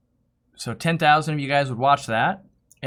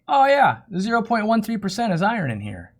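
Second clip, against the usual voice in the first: mock patient correction; 6 semitones higher than usual. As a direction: spell it out to the customer as if it were obvious. Correcting the customer, acted reply.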